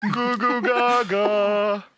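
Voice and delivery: put-on high, slow cooing baby babble; mocking baby talk. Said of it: "That's him doing a sound check".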